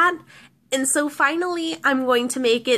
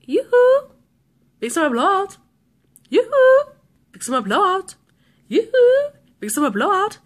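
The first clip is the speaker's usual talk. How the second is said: annoying alarm voice; 7 semitones higher, 4 dB louder than usual. Retold or imitated. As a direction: mimic a chirpy salesman on repeat. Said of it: "the guy's voice is really, really annoying".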